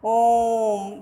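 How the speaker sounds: ओम held out three times as long as a short vowel; prolonged Om. Shown as a demonstration. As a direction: draw out ओम like a call. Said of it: "तो ओम शब्द प्लुप्त स्वर है".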